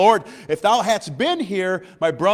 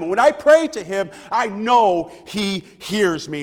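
higher, louder preacher's exhortation; fervent preaching; 5 semitones higher, 4 dB louder than usual. Real, not acted. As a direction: preach it with rising conviction to the congregation. Preaches with force after the reading.

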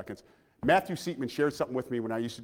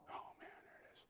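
barely audible mock whisper; whispered groan of "here it comes"; dreading whisper; 27 dB quieter than usual. Kids to each other as dad starts asking, dreading whisper.

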